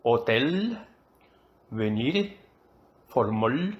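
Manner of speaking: hotel, venir, formol with the final syllable held and rising; stress on last syllables. Shown as a demonstration. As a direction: list them slowly as examples: ho-TEL, ve-NIR, for-MOL, each ending stretched upward. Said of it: "llevan el acento o el énfasis". (hotel, venir, formol con la última sílaba acentuada y alargada)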